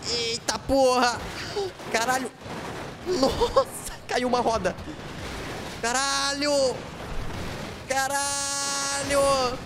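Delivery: high, drawn-out shocked cries; shocked exclaiming. Real, not acted. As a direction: stretch each shocked cry out long. Game car loses a wheel.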